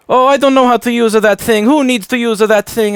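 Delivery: high loud mock boastful relative; proud old relatives. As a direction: brag about not knowing, mocking. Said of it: "with them, it's like".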